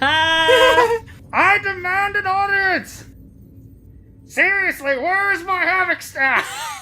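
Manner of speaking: very high cartoon villain demand; squeaky villain, slow and grand; Skeletor voice. Skeletor demanding his staff.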